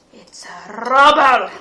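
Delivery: alarm. Acted. Dwarves suspect a robber.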